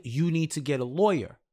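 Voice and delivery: ordinary delivery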